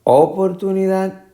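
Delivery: Oportunidad high, with O punched; misplaced first stress. Shown as a demonstration. Teacher repeating options, O-portunidad. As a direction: hit the opening O of Oportunidad. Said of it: "la fuerza de la pronunciación".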